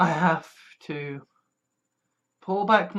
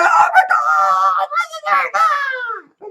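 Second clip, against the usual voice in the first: much higher, slow, loud mock panic; freaking-out body; 7 dB louder, much slower than usual. Body part freaking out at pain, high slow mock panic. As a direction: gasp it like a body in alarm. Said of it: "your body that's freaking out, going".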